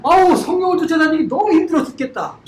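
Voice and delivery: a preacher mocks a weary complainer; mock whining complaint. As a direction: whine it like a tired believer. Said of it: "이 말이 안 되잖아".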